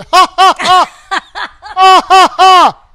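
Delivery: loud high mock cackling laugh; imitated big laugh. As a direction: belt out a hammy booming laugh. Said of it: "What about the laugh?".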